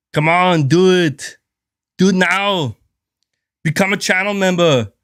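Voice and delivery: hammed-up Arnold barking commands at chat; Arnold impression. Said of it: "I'm over here screaming like Arnold".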